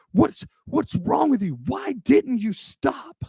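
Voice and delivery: gasping indignation, dragging out the why; breathless guard. Retold or imitated. Guard still panting demands why the driver didn't stop, high winded voice. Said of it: "But he's breathing heavy".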